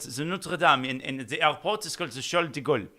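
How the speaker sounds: mock French accent, playful. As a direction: put on a joking French accent for the place names. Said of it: "but in a French accent".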